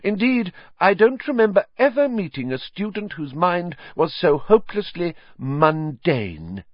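crush her with haughty disdain; cold anger. Acted. Trelawney dismissing Hermione, high icy anger. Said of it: "unmistakable anger".

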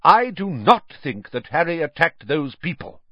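measured but insistent; loudly firm. A narrator's reading. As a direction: state it firmly to end the argument. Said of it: "said Dumbledore loudly".

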